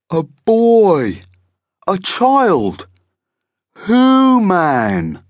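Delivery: high, loud, drawn-out monster voice; marvelling monster, unfamiliar word. A narrator's reading. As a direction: stretch out each strange new word. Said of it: "as if he didn't use it very often".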